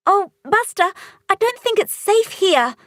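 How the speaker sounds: put-on high girlish voice, uneasy; a young girl fretting about danger; scared little girl voice; Polly, worried. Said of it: "she says".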